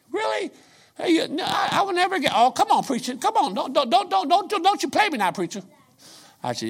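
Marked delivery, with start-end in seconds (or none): 0.1-5.6 high-pitched, shouting disbelief; excited protest. Retold or imitated. Young man protesting to the preacher in high excited disbelief. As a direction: squeal it out like you can't believe you're caught.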